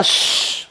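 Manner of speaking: real unvoiced "shh"; quick hush to quiet a pet; shushing. Real, not acted. Speaker interrupting the recap to hush the cat, real shush.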